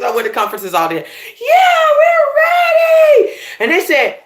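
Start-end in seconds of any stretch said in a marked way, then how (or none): 1.4-3.4 loud, high, long-drawn chant; slow, stretched rallying holler; hyped crowd yell. Retold or imitated. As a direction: imitate a fired-up audience shouting back. Retold conference crowd answering eagerly.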